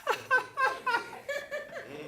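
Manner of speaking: a burst of high, real laughter. Real, not acted. Laughing at own quip.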